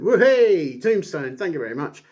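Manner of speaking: loud high hammed-up cheer; mock gleeful finisher call. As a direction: shout the move like a gleeful wrestler. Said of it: "The Undertaker just went".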